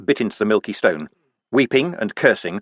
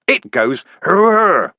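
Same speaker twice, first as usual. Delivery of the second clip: higher, loud, very slow yell; wild roar; 7 semitones higher, 5 dB louder, much slower than usual. Acted. Vimes hurling the axe at the guards, roaring. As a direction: bellow it as you throw the axe.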